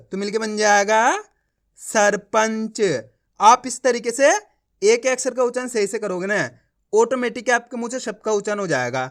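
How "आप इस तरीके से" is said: ordinary talk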